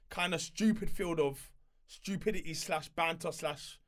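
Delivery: ordinary delivery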